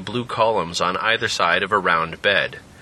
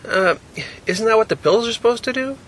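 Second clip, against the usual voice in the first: put-on puzzled young man; 13 semitones higher than usual. Jack asks, higher and louder.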